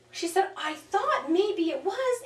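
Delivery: retelling the owner's startled, slow dawning; imitated gasp of realization. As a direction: mimic the owner's wonder, drawn out and lifted. Client realizes dog is reincarnated.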